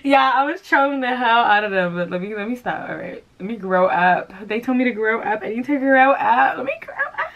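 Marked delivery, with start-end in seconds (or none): 0.0-2.0 giggling through the words; high giddy laughter fading into speech; laughing. Real, not acted. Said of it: "the laughing jitters". 5.4-7.3 mocking tone. Mocking the grow up comment.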